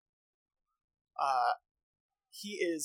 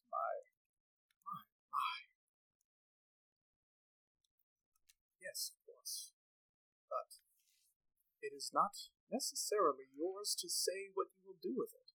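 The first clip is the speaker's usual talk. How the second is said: lightly voiced old wizard, quiet and musing; a hint of Radagast the wizard; 4 semitones higher, 9 dB quieter than usual. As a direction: murmur it like a wise old mage weighing the matter.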